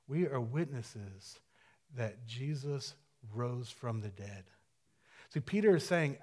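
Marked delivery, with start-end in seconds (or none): 0.1-4.5 hushed. Acted. Repeating the line softly and slowly.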